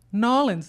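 "Nawlins" in two quick syllables, NAW-lins; the local name "Nawlins", slurred short. Shown as a demonstration. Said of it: "that's New Orleans for you guys".

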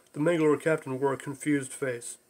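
ordinary delivery

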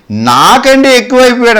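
high-pitched mock reproach; sarcastic taunt. God teasing Abraham about his son, mock-reproachful. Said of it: "ఎటకారం అన్నమాట అది".

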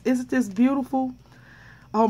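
usual speaking voice

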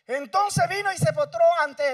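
loud preaching. Acted.

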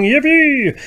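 very high loud cheer; yippee cheer. Acted. Bunnies cheering in the book, very high and loud. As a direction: squeal it with joy. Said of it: "danced and sang a happy song".

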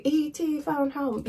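slow, high, soft little alien voice; E.T. impression. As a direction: say it slowly like E.T. phoning home. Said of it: "it's kind of like E T".

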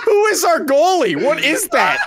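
laughing disbelief. Real, not acted. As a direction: shout it while cracking up. Disbelief at the goalie.

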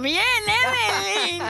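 loud slow cheer. Real, not acted. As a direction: cheer her on loudly, drawn out. Cheering Evelyn's joke.

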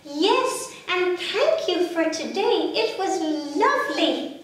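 put-on high little baby thanking; a small child saying thanks, pitched high.

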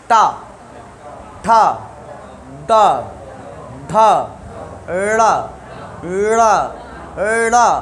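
टा, ठा, डा with tongue curled to the roof; each of टा, ठा, डा, ढा, ड़ा struck apart, tongue curled back; retroflex demonstration. Shown as a demonstration. Teacher showing students where the tongue strikes, retroflex टा, ठा, डा. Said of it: "वहीं पर उच्चारण करेंगे".

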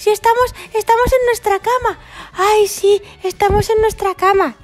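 very high, fast mock fright; high frantic dinosaur fear; mock panic. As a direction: squeal in hammed-up terror.

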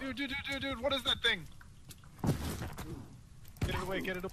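shrill, high panicked pleading; scared stiff, begging it away; phobic panic. Real, not acted. Creature attacks, pleading.

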